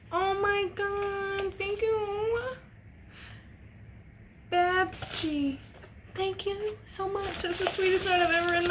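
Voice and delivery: high, soft, slow, moved to tears; touched and tender. Real, not acted. Vlogger thanking a fan for a child's note, high and tender. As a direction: melt at the sweet note.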